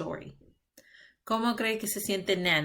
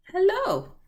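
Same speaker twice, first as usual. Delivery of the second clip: hello; 11 semitones higher, 4 dB louder than usual. Shown as a demonstration. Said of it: "we might also say".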